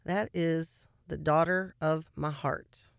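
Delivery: low, slow, softened, heartfelt voice; unhurried and softly proud, imitating a doting mother-in-law; quietly proud mother-in-law. Mother-in-law marvels at the daughter of her heart, quietly proud. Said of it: "it wasn't this high energy".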